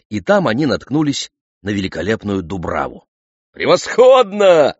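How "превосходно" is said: high, loud, drawn-out exclamation; excited cry. A narrator's reading. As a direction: cry it out with delight, stretching the word. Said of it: "вскричал он".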